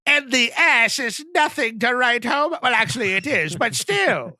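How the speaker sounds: put-on high, loud, slow old lady; Norma Bates. Mother grudgingly judging the guest, hammed-up old lady. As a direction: scold grandly, then grudgingly concede. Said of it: "you just hear over the P A".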